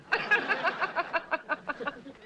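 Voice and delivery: high teasing chuckle; gloating laughter. Acted. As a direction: titter after winning the exchange. Laughing after putting him down.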